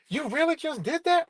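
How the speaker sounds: high, incredulous "you really just did that"; can't believe she actually called; disbelieving question to his ex; stunned disbelief. Retold or imitated. Disbelief at ex calling police.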